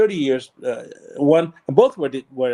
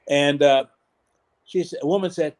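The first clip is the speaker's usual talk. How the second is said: usual speaking voice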